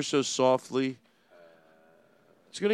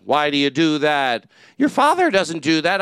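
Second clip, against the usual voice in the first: Kevin Meaney impression; 7 dB louder than usual. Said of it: "Kevin Meaney".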